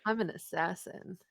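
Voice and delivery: low, soft, breathy. Pretend. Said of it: "what does Kasha sound like".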